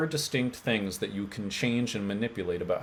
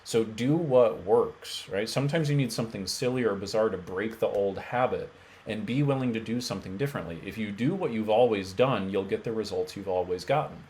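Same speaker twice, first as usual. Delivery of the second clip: ordinary talk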